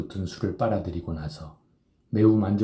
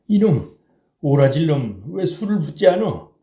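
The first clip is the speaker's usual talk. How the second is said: put-on higher, louder drunken bellow at the boy; drunken scolding; 8 semitones higher, 6 dB louder than usual. A narrator's reading. Drunk Kim yelling at the serving boy for more, angry roar. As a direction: tear into the serving boy, loudly. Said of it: "야단을 쳤다".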